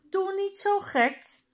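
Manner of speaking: high, loud outburst of disbelief; shocked. Acted. Jan reacting to Tom's wish, in loud disbelief. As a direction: snap it out in shock.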